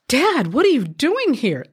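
high, drawn-out astonished exclamation; stunned, stretching the words out in disbelief; shocked amazement. Retold or imitated. Teenager unexpectedly finding her father in the store, shocked amazement. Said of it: "Absolutely in shock and amazement".

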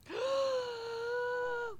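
sharp high gasp of horror; shocked gasp. Real, not acted. Shocked by creepy story.

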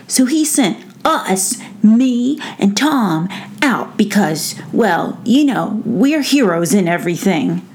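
perky mouse voice, starting high; little mouse puffing himself up; boastful mouse. Acted. Brisbane explains why the prince sent them out, self-important.

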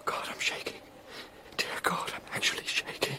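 trembling, breathless whisper; shaken, barely voiced fear; frightened whisper. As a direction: whisper it as if your nerve is gone. Terrified after seeing the rat.

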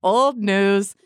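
hammed-up "get over it" teasing; playfully mock someone for dwelling on it; teasing mock dismissal. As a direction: imitate a joking brush-off, drawn out then pitched high. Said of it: "He would just laugh and go".

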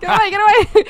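high, loud frantic yelling; panicked shout. Real, not acted. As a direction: yell in a laughing panic. Panicking at creepy caller.

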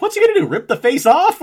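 high, loud retold question; incredulous reaction. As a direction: act out someone aghast at the screen. Retold startled reaction to the film.

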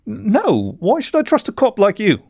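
high, tearful distrust; acted upset, pitched well up; sobbing protest. A narrator's reading. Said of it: "He kept sobbing".

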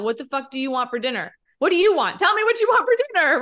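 rising from irritation to a high, loud plea; exasperated demand. Acted. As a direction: push harder with each question, speeding up at the end. Voices exasperation at an indecisive partner.

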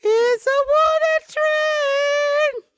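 very high, slow squeaky puppet voice; a creepy clown puppet teasing slowly; squeaky ventriloquist dummy. Acted. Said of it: "this voice when I'm laughing".

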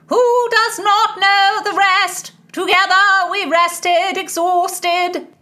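high, loud declaimed verse; bellowing. A narrator's reading. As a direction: bellow the verse in drawn-out words.

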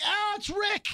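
high, excited recognition; suddenly spot a familiar face. Retold or imitated. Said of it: "I'm like".

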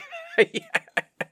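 squealing laugh way up high; high delighted laugh. Real, not acted. Host laughing with glee at his Nerdvana luck. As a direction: let out a giddy high-pitched laugh.

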